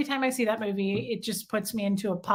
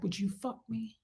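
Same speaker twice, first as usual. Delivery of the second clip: very soft, creepy murmur; creepy villain; 14 dB quieter than usual. Retold or imitated. Fan quoting a creepy movie scene, hushed villain imitation. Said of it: "It's so creepy".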